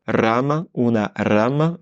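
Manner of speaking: "rama" with a trilled initial r; rolled r. Shown as a demonstration. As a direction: roll the r in "rama". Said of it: "on change de voyelle".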